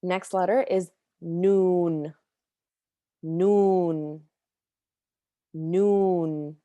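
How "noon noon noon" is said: "noon" said slowly with a plain N; demo noon. Shown as a demonstration. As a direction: say noon clearly for learners. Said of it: "Noon is just an N".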